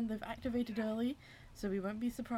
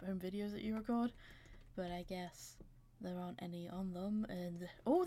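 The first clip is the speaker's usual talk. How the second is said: ordinary delivery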